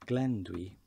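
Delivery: "Glenn Dwi", stress on Glenn, rising; Welsh informal. Shown as a demonstration. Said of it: "put your name first".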